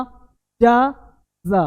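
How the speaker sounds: पिज़्ज़ा stretched very slow and loud with a long z sound; drawn-out pizza. Shown as a demonstration. Teacher to students, stretching पिज़्ज़ा slowly and loudly. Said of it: "देखो ध्यान देना".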